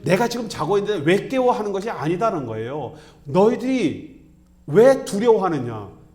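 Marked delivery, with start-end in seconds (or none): 0.0-1.5 loud, higher-pitched mock complaint; acted-out annoyed protest; irritated outburst. Imagined annoyed Jesus woken up. 3.2-6.0 loud, high, forceful rebuke; acted-out thundering reproach; Jesus. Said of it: "이걸 책망하시는 겁니다".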